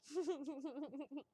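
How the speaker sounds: light, rippling laugh; real little chuckle; giggle. Real, not acted. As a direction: giggle to oneself. Chuckles about crying easily.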